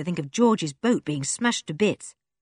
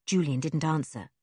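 plain reading voice

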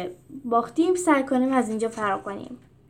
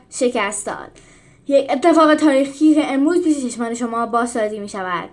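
loud, higher arena proclamation; announcer; 4 semitones higher, 7 dB louder than usual. A narrator's reading. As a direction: declaim to the crowd. Referee continues to crowd.

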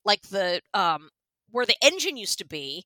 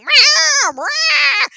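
loud, very high crying meows; shrill yowling kitten cries; cat meowing; 10 dB louder than usual. Retold or imitated. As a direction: scream like a desperate stray cat. Cat screaming at them.

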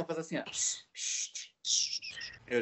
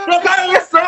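high, loud panicked refusal; panicked yell; 13 dB louder, faster than usual. Retold or imitated. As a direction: shout it, half joking panic.

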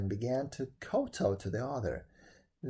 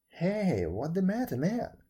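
higher, louder call of alarm; startled cry; 5 semitones higher, 4 dB louder than usual. A narrator's reading. Lin calls out, thinking Wen is in a fit, startled. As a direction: cry out in concern at a sudden fit. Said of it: "cried Lin".

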